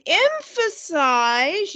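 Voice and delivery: "emphasize" drawn out slowly for effect; deliberate, slowed stress on "emphasize"; emphasized word. Shown as a demonstration. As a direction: stretch out "emphasize" so it stands out. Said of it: "you sound differently when you say a word".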